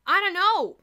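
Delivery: high, loud harried policeman; calling back. A narrator's reading. Barney to his partner, calling back loudly. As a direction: call back while fending off girls. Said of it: "Barney called back".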